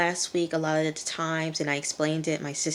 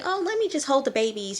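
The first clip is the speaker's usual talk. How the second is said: mock sweet neighbor; 9 semitones higher than usual. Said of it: "She's like".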